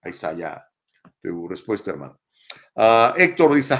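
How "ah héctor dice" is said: laughing while starting to read, loud and slow. Real, not acted. Reading Héctor's comment to viewers, laughing. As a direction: chuckle as you begin the comment.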